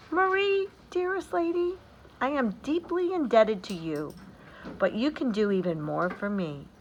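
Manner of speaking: high, light voice of the little Nutcracker. Acted. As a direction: speak up high like a devoted toy soldier. Nutcracker speaks to Marie.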